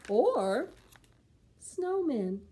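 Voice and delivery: slow, expressive picture book reading; storybook voice for preschoolers; reading to children. Acted. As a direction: read it slow and lively for little ones.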